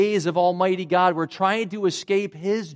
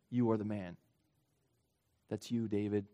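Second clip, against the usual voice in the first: low, soft, pointed: you are the man; quiet accusation; 8 semitones lower, 11 dB quieter than usual. Retold or imitated. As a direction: drop the voice and accuse him gently but firmly. Nathan confronts David with his sin.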